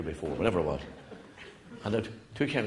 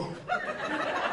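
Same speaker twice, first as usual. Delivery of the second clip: breathy laugh; 6 dB quieter than usual. Real, not acted. Teller cracks up.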